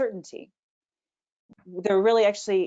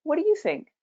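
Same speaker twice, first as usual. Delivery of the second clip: "What do you think" pitched well up; inviting question; 9 semitones higher than usual. Shown as a demonstration. Coach showing how to invite a response, high questioning tune. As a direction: open it out to the listener, much higher voice. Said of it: "you might ask for a question".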